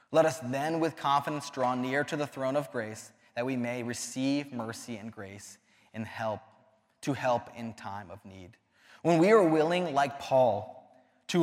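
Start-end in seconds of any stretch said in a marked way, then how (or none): 0.1-8.5 softer Bible reading trailing off; soft scripture reading. Acted. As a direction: repeat the verse gently, almost to yourself. Repeats the verse softly.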